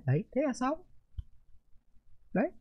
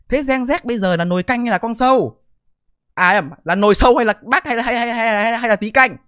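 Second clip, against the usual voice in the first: louder, higher sarcastic jab; mocking taunt; 5 semitones higher, 8 dB louder than usual. Real, not acted. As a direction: needle them with a loud mock riddle. Mocking riddle to critics.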